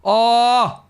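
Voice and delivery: high loud cry of frustration; anguished yell. Real, not acted. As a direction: let out a pained shout. Raging at own misplay.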